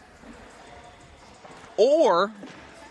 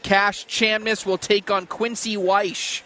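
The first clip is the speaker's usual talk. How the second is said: announcer voice; 4 semitones higher than usual. Acted. Announcing next matches.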